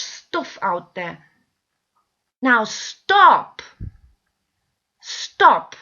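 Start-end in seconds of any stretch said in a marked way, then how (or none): 2.4-5.8 louder, firm "stop" to the viewers; loud stern command. Real, not acted. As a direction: order them to stop, hard.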